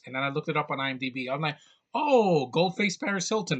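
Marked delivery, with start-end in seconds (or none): 1.9-3.6 high, loud "oh" of realization; sudden recognition, starting high and easing down; delighted recognition. Retold or imitated. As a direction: act out finally placing a face. Acting out his moment of recognition.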